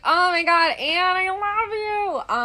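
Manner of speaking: high, drawn-out adoring squeal; gushing affection. Retold or imitated. Replying to the maintenance lady in the hallway, gushing. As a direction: gush it loud and stretched out.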